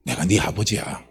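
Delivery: low, soft fatherly voice; quiet, deep-pitched tender assurance; fatherly reassurance. Acted. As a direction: reassure gently, dropping right down. God reassures the despairing person.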